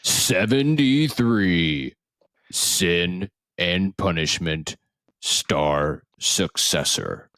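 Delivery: slow, drawn-out gala announcer. Acted. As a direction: announce each title like a trophy. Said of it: "It's like we're at the Game Awards".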